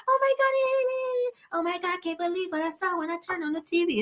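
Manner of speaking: LaToya's fake media voice. Mocking her put-on voice for the cameras. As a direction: stretch it out high and phony like a TV persona. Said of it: "with that fake ass voice".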